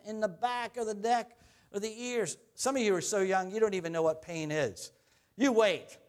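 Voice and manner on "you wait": put-on loud, emphatic old-timer warning; old person warning. Shown as a demonstration. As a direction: bark it like a grumpy elder. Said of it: "now I sound like an old person".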